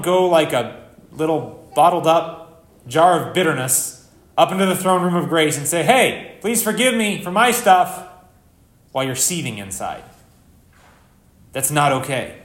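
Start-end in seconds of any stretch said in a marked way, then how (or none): 5.8-8.1 higher, louder mock plea; mock entitled request for pardon; brash plea. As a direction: plead loudly, drawing out the request. Acted demand for forgiveness while seething.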